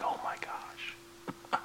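breathless, hushed whisper of amazement; awed whisper. Pretend. Believer stunned as a stranger breaks down, whispering in awe. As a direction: whisper it as if you can't believe it.

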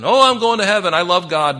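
loud, high-pitched pretend enthusiasm; imitating an eager believer; mock gushing. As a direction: proclaim it with hammed-up confidence. Mimics people professing their faith.

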